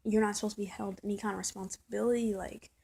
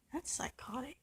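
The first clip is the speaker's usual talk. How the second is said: whispered, pitched up; stunned hushed whisper; shocked whisper; 4 semitones higher, 7 dB quieter than usual. Real, not acted. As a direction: breathe it out in disbelief. Repeating a shocked verdict.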